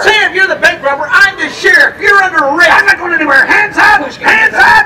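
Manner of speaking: sheriff arrest. Acted. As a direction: bark orders like a movie lawman. Father plays sheriff arresting robber.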